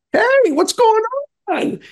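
very high, imitated friendly holler; cheery call-out. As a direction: hail friends as if from a truck. Friends calling from pulled-up truck.

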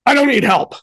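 high, exasperated protest; frustrated. Retold or imitated. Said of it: "like, I'm frustrated".

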